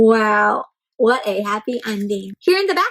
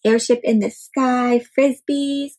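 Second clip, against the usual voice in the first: plain reading voice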